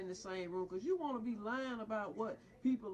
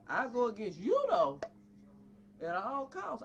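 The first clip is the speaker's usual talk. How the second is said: loud defiant burst; defiant shout; 6 dB louder than usual. Real, not acted. Father defying the psychiatrist with a loud shout. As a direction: slam the word though.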